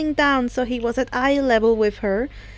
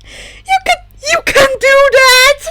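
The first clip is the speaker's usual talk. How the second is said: squeaky, much higher voice breaking; high-pitched; 12 semitones higher, 8 dB louder than usual. A narrator's reading. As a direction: squeak it in shock. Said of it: "asked in high-pitched voices".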